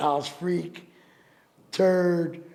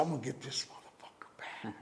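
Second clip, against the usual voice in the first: hushed, scheming whisper; conspiratorial whisper; 5 semitones lower, 16 dB quieter than usual. Retold or imitated. Whispering to his boy about payback. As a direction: whisper the plan like a secret. Plotting revenge, whispered aside.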